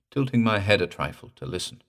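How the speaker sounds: plain reading voice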